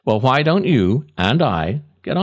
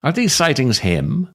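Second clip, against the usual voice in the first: solemn eulogy. Acted. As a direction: read it like a mock-solemn eulogy at the graveside. Mock eulogy read over music.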